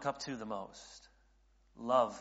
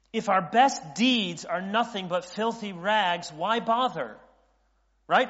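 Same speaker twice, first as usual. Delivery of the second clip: loud, higher-pitched protest in a believer's mouth; exasperated doubter; 6 semitones higher, 8 dB louder than usual. Acted. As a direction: argue hopelessly, piling up the complaints. Voicing believers who doubt holiness.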